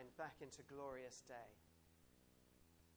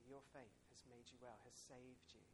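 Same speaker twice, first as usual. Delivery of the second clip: gentle Jesus; 2 semitones lower, 10 dB quieter than usual. Retold or imitated. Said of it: "He'll say".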